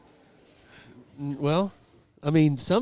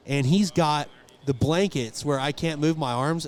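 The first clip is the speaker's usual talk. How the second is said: ordinary talk, nothing marked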